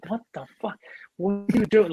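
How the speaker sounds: high-pitched imitation of baffled disbelief; retold, high-voiced bewilderment; bewildered reaction. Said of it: "he didn't even know what was happening".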